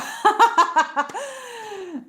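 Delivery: long, high, loud laugh; real cackling laugh that runs on. Real, not acted. Laughs off her remarks on Italians.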